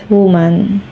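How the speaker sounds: normal talking voice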